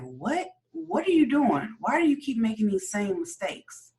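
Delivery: imitating someone's pleading, high-pitched challenge; exasperated questioning. Co-host retelling someone confronting Joe about his mistakes, exasperated questioning. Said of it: "somebody finally asked him, like".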